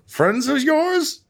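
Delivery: very high, slow sneer; mocking villain. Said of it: "Hawaiian Strahd be like".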